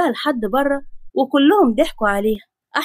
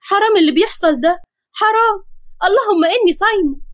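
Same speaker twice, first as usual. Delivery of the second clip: higher, pained whimpering complaint; 7 semitones higher than usual. Acted. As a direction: moan it while holding your sore back. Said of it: "ماسكة ظهرها بوجع". (بتتوجع وصوتها عالي)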